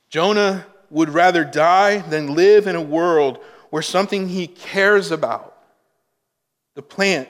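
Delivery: loud, driving sermon voice, pressing each phrase; preaching at full force. Acted. Preacher stirs the congregation over Jonah's despair, loud and driving. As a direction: hammer it home to the congregation.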